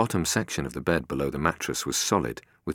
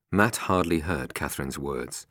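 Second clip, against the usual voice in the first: ordinary delivery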